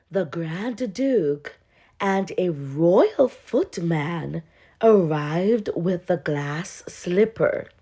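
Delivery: ordinary delivery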